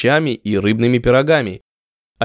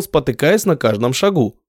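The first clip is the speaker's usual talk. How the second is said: usual speaking voice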